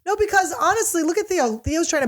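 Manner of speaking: high, animated protest; excited. Real, not acted. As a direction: jump in with high energy. Excited defense of Theo.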